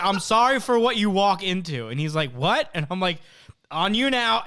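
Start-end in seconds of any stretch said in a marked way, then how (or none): 0.0-1.9 raised, louder warning call; high, loud mock apology called out; retold yell. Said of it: "I just yelled". 2.3-2.7 retold puzzled reply. Retold reply called from doorway. 3.7-4.4 high, loud called-out reply; retold yell back. Retold yelling back to Phil.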